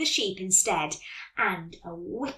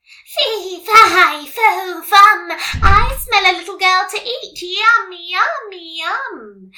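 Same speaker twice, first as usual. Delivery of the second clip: high, loud sing-song witch; big pitched-up witch chant, long drawn-out words; witchy voice; 10 semitones higher, 12 dB louder, slower than usual. A narrator's reading. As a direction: chant it as a hungry storybook witch. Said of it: "came a witchy voice".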